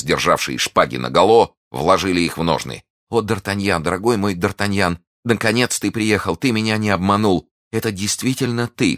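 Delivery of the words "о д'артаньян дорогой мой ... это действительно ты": put-on higher-pitched tender young woman; lifted, emotional voice; overjoyed reunion. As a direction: greet your love with happy relief. Heroine reunited with her lover.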